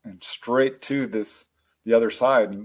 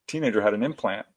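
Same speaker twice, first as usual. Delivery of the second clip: usual speaking voice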